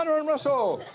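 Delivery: toast call. Real, not acted. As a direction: call out the couple's names. Raising glasses toast.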